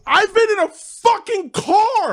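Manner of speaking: high, exasperated protest; exasperated outburst. Retold or imitated. Comic learning the news backstage, exasperated. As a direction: burst out defending yourself. Retelling his panic at the news.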